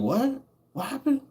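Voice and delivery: high, rising what; ask, baffled; confused question. Retold or imitated. The big homie asking what happened between them, high and baffled.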